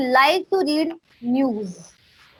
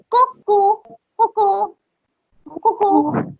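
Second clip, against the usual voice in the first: light, higher, slower sing-song coucou; playful coucou; 6 semitones higher than usual. Shown as a demonstration. Teacher showing students a fun French greeting, playful higher sing-song. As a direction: toss off coucou like a cute greeting. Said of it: "Coucou, it's very funny".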